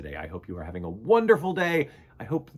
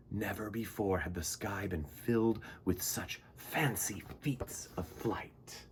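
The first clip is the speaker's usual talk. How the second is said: soft awed whisper; hushed wonder; 5 semitones lower, 8 dB quieter than usual. A narrator's reading. Storyteller whispering the marvel of the flying party. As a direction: breathe it in wonder.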